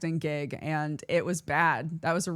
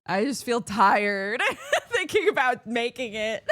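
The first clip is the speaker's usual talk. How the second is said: laughing; 4 semitones higher than usual. Real, not acted. Laughing at own exhaustion.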